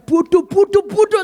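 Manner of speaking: mock self-berating; scolding himself. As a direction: call yourself a fool. Nyalain diri saya.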